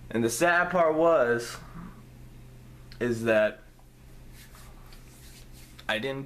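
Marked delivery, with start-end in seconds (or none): none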